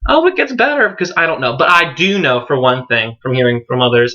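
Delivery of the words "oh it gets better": much higher, sing-song "it gets better"; mock cheesy slogan. Vlogger mocking the usual coming-out slogan, high sing-song. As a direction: parody a greeting-card pep talk. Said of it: "I can't really be like".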